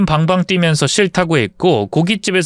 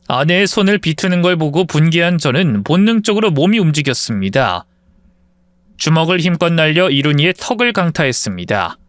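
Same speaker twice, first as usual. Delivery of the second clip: ordinary delivery, nothing marked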